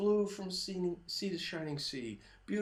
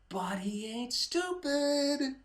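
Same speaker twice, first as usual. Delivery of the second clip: normal talking voice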